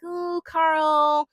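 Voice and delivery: high, drawn-out mock swoon; lightly acted high-pitched delight; happy swoon. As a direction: imitate a happy, smitten coo. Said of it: "And Anaya was happy".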